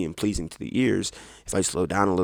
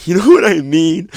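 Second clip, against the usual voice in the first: loud laughing question, much higher; 9 semitones higher, 14 dB louder than usual. Real, not acted. Laughing at own TikTok parody. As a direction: burst out laughing as you ask.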